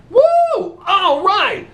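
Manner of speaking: high, loud delighted cheer; joyful whoop. Acted. Nun thrilled by the priest's idea, cheering. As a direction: whoop like you just found the answer. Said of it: "She's like".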